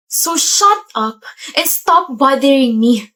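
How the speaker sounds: drawn-out, slowed shut up; slow angry command. Acted. Fed-up student orders her boyfriend away, slow angry command. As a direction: spit out each word slowly. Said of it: "you don't have to shout".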